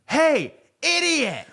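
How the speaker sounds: high, slow jeering yell of "idiot"; hammed-up taunt from a stranger; mock heckle. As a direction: stretch it out like a sneering heckler across the street. Stranger heckling from across street.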